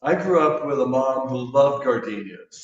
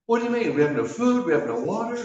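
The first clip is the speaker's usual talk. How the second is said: nervous guy; 7 semitones higher than usual. Acted. Nervous guy protests in joke.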